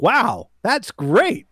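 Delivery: drawn-out, high mock amazement; impressed wow. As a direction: retell it with slow, swooping awe. Retells own impressed reaction to drink.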